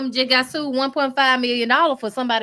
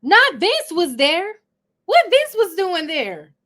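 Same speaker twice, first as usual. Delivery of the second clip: shocked slow exclaim; 5 semitones higher, 5 dB louder, slower than usual. Real, not acted. Shocked at clip detail.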